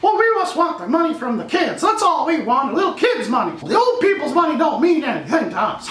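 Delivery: high, sneering studio-exec parody; mocking the studio that only wants kids' money, high and sneering; mock greedy studio.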